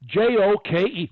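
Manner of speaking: spelling a problem word. Shown as a demonstration. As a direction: spell it out letter by letter. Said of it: "You can spell a word".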